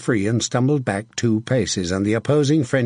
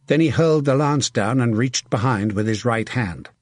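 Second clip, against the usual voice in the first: usual speaking voice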